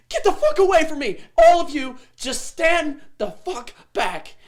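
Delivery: very high, full-force defensive shout; furious captive shouting. Acted. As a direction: scream everyone back, slowing on the last words. Freed captive bursts out at rescuers.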